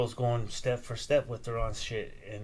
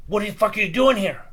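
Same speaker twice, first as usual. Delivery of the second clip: higher, louder angry yell; 8 semitones higher, 6 dB louder than usual. Retold or imitated. Neighbour yells at the boy on second base, angry. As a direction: snap at the kid left standing there. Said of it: "starts yelling and shit".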